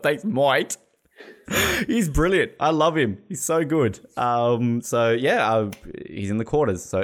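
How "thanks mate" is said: put-on Australian twang, slightly higher; attempted Australian accent. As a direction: overdo the Aussie vowel in "mate". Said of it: "tries to do an Australian accent".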